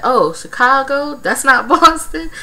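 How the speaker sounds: high, puzzled question; mock confusion. Vlogger mimicking a contestant mixing up cities, mock confused.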